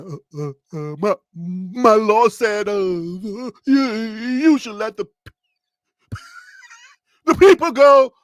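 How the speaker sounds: hammed-up stammering Moses, breaking into a laughing wail at the end; stuttering Moses. Moses stammering his message to Pharaoh, mock pleading wail. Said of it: "Moses going up there like".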